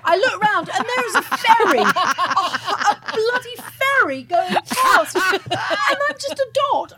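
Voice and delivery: high and loud, half laughing; laughing retelling. Real, not acted. Telling friends the ferry story, laughing hard. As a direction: laugh through the memory.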